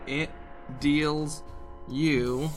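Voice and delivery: normal talking voice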